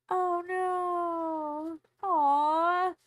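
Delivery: loud, high dismayed aw; loud dismayed reaction. Real, not acted. Reacting on stream.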